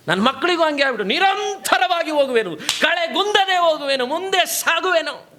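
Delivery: high-pitched, impassioned preacher; preacher in full flow; forceful preaching. Real, not acted.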